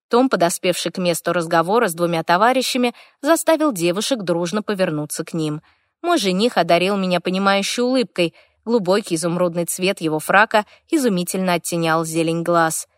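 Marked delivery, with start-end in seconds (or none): none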